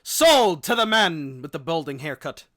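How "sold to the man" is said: loud auctioneer calling the sale. Pretend. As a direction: bang the gavel on the sale. Auction close, going once twice.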